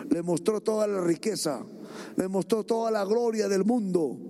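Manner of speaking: ordinary talk, nothing marked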